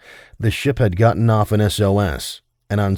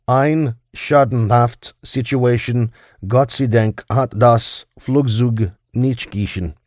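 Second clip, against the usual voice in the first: slow, careful German read from the diary; German diary entry; 4 semitones higher, slower than usual. A narrator's reading. Narrator reading the captain's German diary entry, slowly in German.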